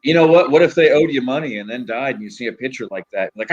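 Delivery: ordinary delivery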